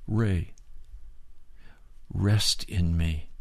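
quiet, slow, gentle reassurance; kind and gentle. Retold or imitated. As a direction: speak his name gently, like a loving father. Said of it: "in a very kind and gentle voice".

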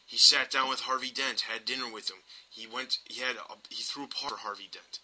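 normal talking voice

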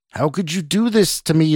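high, insistent cry of accusation; anguished cry. A narrator's reading. Woman closes in on Scott, anguished cry. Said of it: "the woman cried again".

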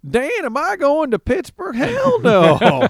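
high-pitched, hammed-up broadcaster impression; McCarver. Veteran broadcaster refusing a Pittsburgh trip, hammed-up refusal. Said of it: "McCarver and".

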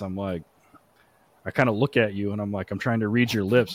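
ordinary talk, nothing marked

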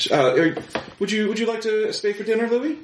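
high, sweet hostess voice; wife's invitation. Acted. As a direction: invite him warmly, voice lifted high. GM voices wife inviting guest.

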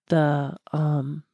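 normal talking voice

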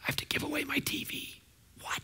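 hushed, high-pitched panicky squeak; mock panic. Preacher voicing a listener told to give things away, mock panic. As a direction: play quiet, squeaky disbelief and alarm. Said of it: "would panic seize your life?".

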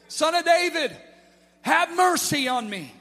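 loud, high desperate plea. Acted. Blind beggar crying out to Jesus, loud pleading. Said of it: "he cried out all the more".

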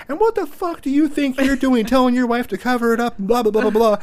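imitating dad laying into me; retold dad yelling. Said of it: "he turns around and lays into me".

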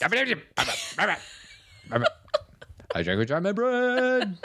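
put-on high, slow Austrian accent; Captain Von Trapp. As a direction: play a stern Austrian captain. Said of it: "how he talks really, really fast".

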